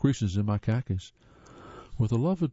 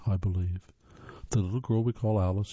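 plain reading voice

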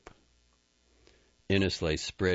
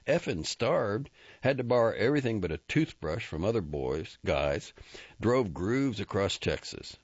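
raised Texan rodeo voice; Jack, boastful and wry; 6 semitones higher than usual. Acted. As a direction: brag and grumble in one breath. Jack brags and complains.

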